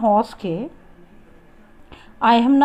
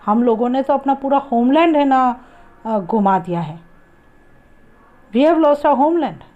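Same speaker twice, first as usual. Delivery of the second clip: retold shout; 3 semitones higher, 4 dB louder, much faster than usual.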